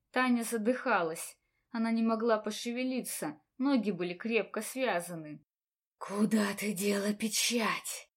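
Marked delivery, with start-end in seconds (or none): 6.0-8.1 angry hiss. A narrator's reading. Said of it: "Со злостью прошипел незнакомец".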